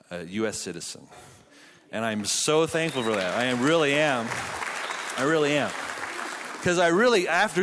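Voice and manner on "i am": ordinary talk, nothing marked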